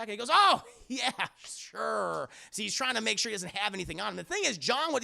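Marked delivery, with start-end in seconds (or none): 0.3-2.3 feigned agreement. Acted. Acting out the man's feigned agreement.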